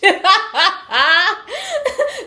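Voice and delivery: loud, high cackle; laughing. Real, not acted. Reader cracking up at own impression, high cackle.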